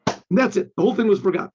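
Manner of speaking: normal talking voice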